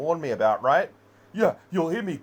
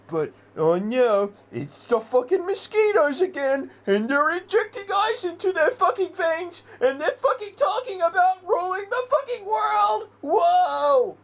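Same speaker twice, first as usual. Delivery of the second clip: winding up higher and higher till he is yelling; climbing frantic shout; 3 dB louder than usual. Real, not acted. Ranting to viewers about drug users, climbing to a frantic yell. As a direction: pitch climbs until it cracks into a near-cry shout.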